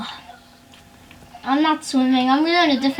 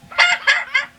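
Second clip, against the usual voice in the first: high cackling witch laugh; witch cackle; 14 semitones higher than usual. Real, not acted. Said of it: "Sound like a witch".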